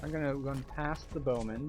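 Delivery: ordinary talk, nothing marked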